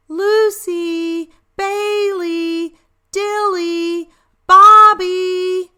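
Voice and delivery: high, loud calls across the woods; calling out. Acted. Mom and Dad calling their lost dogs, loud and high. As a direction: call the names far off into the forest.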